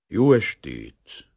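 slow greeting, lightly voiced modest stranger; a hint of a hoarse foreign visitor; drawn-out evening greeting from a shy stranger; modest, slightly hoarse foreigner. A narrator's reading. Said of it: "szerény hang, kissé rekedtes, idegenes kiejtéssel".

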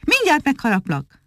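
high-pitched, teasing threat at ordinary volume; crying out a threat. A narrator's reading. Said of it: "kiáltotta most Muki".

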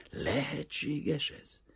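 high, slow, hushed disbelief; incredulous. A narrator's reading. Banker doubting his own ears after refusal, incredulous. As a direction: ask it slowly, barely believing.

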